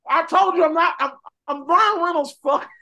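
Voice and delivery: high, loud sarcastic Deadpool voice; Ryan Reynolds as Deadpool. Retold or imitated. Deadpool correcting the Blades, high and loud. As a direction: play Deadpool mocking the others. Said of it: "All three of them say".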